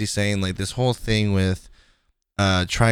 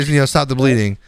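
normal talking voice

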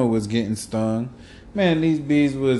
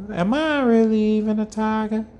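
Katt Williams impression; 6 semitones higher than usual. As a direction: quote Katt Williams, pitched up. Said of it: "That's Cat Williams".

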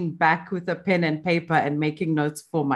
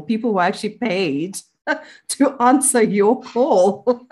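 laughing; 5 semitones higher, 5 dB louder than usual. Real, not acted. Joking about pension call centres.

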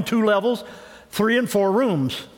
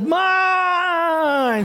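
proud frog shout; 8 semitones higher than usual. Acted. Frog proudly claiming the idea, shouting. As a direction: crow it out proudly.

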